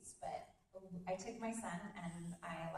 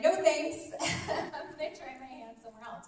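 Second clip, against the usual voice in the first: loud, higher brush-off through laughter; laughing refusal; 6 semitones higher, 11 dB louder than usual. Acted. Coach joking she'd refuse the contract, loud and laughing. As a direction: laugh off the offer. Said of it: "I would have been like".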